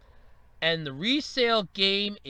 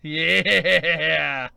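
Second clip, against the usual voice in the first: loud, low, long yeah; gloating yeah; 4 semitones lower, 5 dB louder than usual. Acted. Console maker gloating over price, smug drawn-out yeah. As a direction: draw it out loudly with relish. Acted gloating answer in bar skit.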